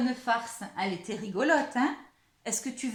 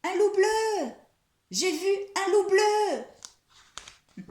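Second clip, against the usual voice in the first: high, loud mock alarm, drawn out; crying out loudly; 9 semitones higher, 6 dB louder, slower than usual. A narrator's reading. Mouse pranking her friends with a false alarm, loud cry. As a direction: shout it high, stretching the words. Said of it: "elle crie très fort".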